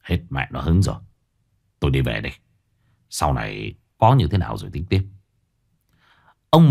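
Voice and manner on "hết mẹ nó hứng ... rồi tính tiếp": low sullen grumble; low irritated muttering; grumbling. A narrator's reading. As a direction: grumble it while dressing. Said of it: "ông càu nhàu".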